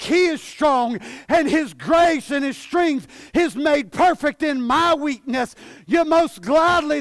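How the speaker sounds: high, loud, driving sermon delivery; ringing, insistent pulpit proclamation; full-force preaching. Real, not acted. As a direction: preach it out at the top of your voice.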